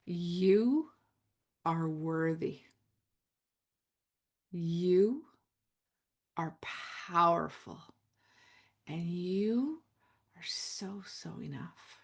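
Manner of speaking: slow, soft and low, each phrase left to land; hushed, tender affirmations fading to almost nothing. Shown as a demonstration. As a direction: speak it gently, like a hand on the heart. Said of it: "just receive".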